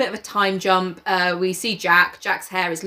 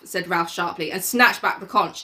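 plain reading voice

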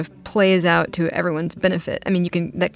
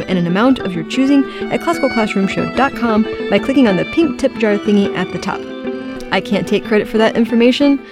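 chipper 1950s ad; 3 semitones higher, 4 dB louder, faster than usual. Acted. Mock nutrition-label podcast ad.

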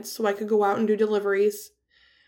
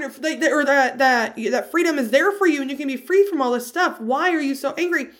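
higher, indignant mock outrage; imitating angry survivors; 7 semitones higher, 3 dB louder than usual. Survivors lashing out at her message of healing, indignant. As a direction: voice their baffled fury at you. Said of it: "no one angrier than other domestic violence survivors".

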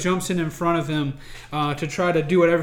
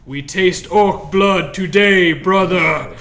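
slow, louder warrior's vow; grim battle vow; 7 dB louder, slower than usual. A narrator's reading. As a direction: declare it to your brother, heavy and proud. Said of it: "puts up his hand and says".

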